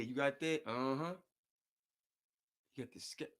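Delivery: normal talking voice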